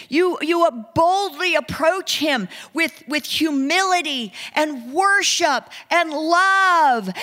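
high, loud, impassioned sermon delivery; fervent preaching. Real, not acted. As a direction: preach it boldly, stretching the key words. Preaches at rising full force.

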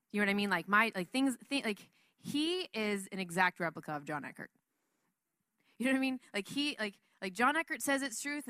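normal talking voice

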